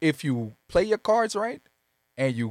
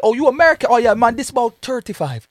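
island vendor voice; 4 semitones higher, 8 dB louder than usual. Retold or imitated. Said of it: "They gonna be like".

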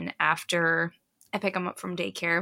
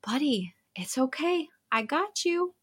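put-on tender reassurance in a higher child voice; gentle big brother; 8 semitones higher than usual. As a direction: imitate a kid soothing his little brother. Big brother comforting younger brother, retold.

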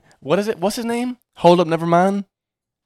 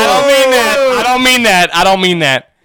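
loud insistent backpedaling; 8 semitones higher, 10 dB louder, slower than usual. Real, not acted. Taking back "fuck the people.

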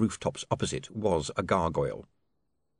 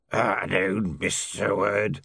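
lightly croaked gnoll voice; croaking creature voice; much slower than usual. Acted. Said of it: "croaked the creature".

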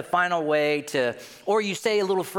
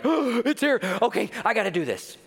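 high, loud, eager burst; excited crowd; 10 semitones higher, 3 dB louder than usual. Acted. Preacher acts out people eager to get in, excited. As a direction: gasp and rush in excitedly. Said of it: "everybody's excited to get in".

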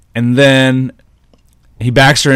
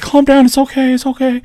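much higher, mock soothing teacher; 13 semitones higher than usual. Teacher calming the screaming student, high mock voice. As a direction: coo it like a fussy teacher. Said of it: "It's like".

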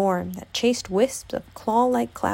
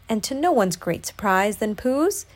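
ordinary delivery

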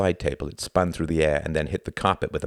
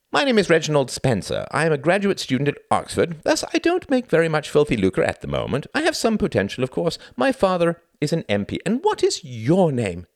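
high, raised-voice mock formality; mock introduction; 10 semitones higher than usual. Husband pretends to introduce himself to wife, sarcastic. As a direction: introduce yourself with pointed sarcasm.

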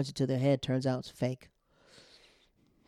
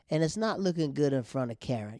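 usual speaking voice

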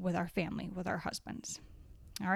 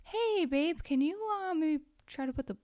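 gently asking, higher and sweeter; sweet request; 6 semitones higher than usual. Retold or imitated. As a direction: coax him kindly in a higher voice. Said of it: "it's okay to say".

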